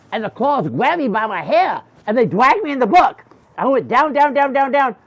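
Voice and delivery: high, loud, breakneck drama; excited storytelling. Acted. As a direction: tell the capture high and loud. Excited telling of being dragged down.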